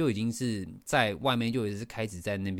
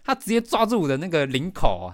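ordinary talk, nothing marked

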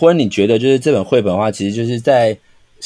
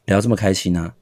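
quiet sarcasm; 4 semitones lower, 6 dB quieter than usual. Retold or imitated. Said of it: "然后就会默默拿起麦克风说：".

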